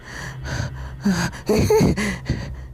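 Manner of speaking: breathless unhinged laugh; crazed laugh. Acted. Starlight losing her mind on the stage, crazed breathless laugh. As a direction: laugh as sanity slips. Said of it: "She gave a crazed laugh".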